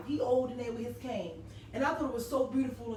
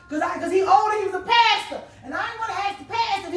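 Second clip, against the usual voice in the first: louder and higher, all worked up; excited outburst; 7 semitones higher, 8 dB louder, faster than usual. Real, not acted. Telling the audience why she hesitated to ask the old pastor, excited and loud. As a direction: push it out like you can't hold it in. Excited storyteller building to punchline.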